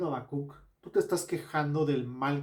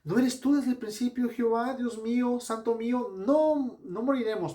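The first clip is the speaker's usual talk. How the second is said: pleading prayer; 8 semitones higher, 3 dB louder than usual. Retold or imitated. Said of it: "el versículo doce dice".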